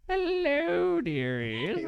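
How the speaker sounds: put-on high, frail old lady voice. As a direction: croak it like a tiny old granny. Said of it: "frail old voice call to enter".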